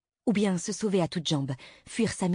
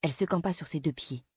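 ordinary delivery, nothing marked

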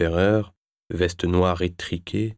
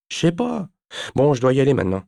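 put-on high-pitched, brushing-off teen voice; evasive teenager; 7 semitones higher, 4 dB louder, faster than usual. A narrator's reading. Teenager dodging Nicky's question about money, evasive. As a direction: shrug the question off and make an exit.

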